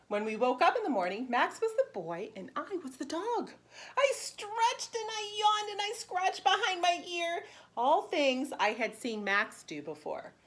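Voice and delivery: bright, lively read-aloud for preschoolers; animated picture-book narration; storybook reading. Acted. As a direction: read it like story time for little kids. Teacher reads picture book aloud.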